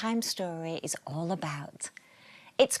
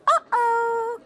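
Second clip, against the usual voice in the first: put-on high, loud little boy's uh-oh; high child voice, alarmed; small boy's uh-oh; 8 dB louder than usual. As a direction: squeak it like a worried little boy. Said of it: "cried Paul".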